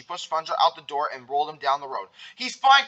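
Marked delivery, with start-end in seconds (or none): 2.3-2.9 high, loud protest; crying out. A narrator's reading. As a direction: call out urgently after someone. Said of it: "cried Patrick".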